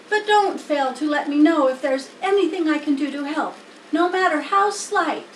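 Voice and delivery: a worried piglet begging for hope; young pig Wilbur. Acted. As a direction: Wilbur asks the spider to save him. Wilbur voiced by reader.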